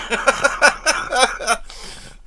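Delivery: laughing. Real, not acted. Laughing at own bad impression.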